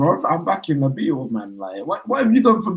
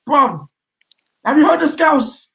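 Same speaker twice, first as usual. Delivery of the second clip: high, loud appeal; worked-up, pitched-up protest; incredulous outburst; 12 semitones higher, 5 dB louder, slower than usual. Real, not acted. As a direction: exclaim it in comic disbelief. Animated rant about worst accent.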